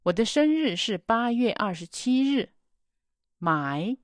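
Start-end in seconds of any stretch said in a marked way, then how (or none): none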